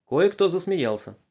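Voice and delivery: ordinary delivery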